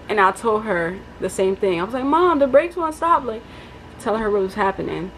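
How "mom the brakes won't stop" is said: higher, slower urgent plea; frightened report to mom about the brakes; panicked. Retold or imitated. Retold call to mom.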